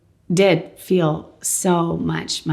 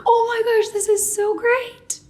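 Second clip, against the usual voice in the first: thrilled. Retold or imitated. Husband hearing the scan was clear, high and elated. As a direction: burst with joy at good news.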